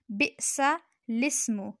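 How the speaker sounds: बिसलिस्मु very slow, lam with zer joined to seen; slow correct reading. Shown as a demonstration. Teacher repeating the correct form slowly. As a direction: drag out बिसलिस्मु syllable by syllable. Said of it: "लाम के नीचे ज़ेर है".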